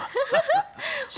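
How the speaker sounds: high, light giggle. Acted. Friends greeting Wallace playfully. (咯咯轻笑)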